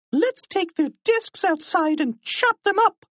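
very high, squawky character voice; high-pitched excited cheering; gleeful teacher cry. A narrator's reading. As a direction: cry it high with mean glee. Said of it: "cried Miserable".

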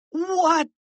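put-on high, eager boy's question; high-pitched anxious query; urgent question. A narrator's reading. Harold asks urgently for the plan.

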